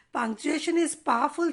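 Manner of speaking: ordinary talk, nothing marked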